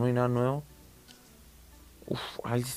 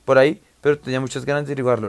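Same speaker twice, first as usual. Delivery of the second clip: story narration; 2 semitones higher, 5 dB louder, faster than usual. Acted. Reads the game's story text.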